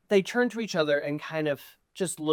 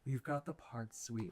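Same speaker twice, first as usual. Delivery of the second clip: much softer, low purring verdict; 3 semitones lower, 16 dB quieter, slower than usual. Acted. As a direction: hand out the part in a soft purr. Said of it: "turns back ... and says".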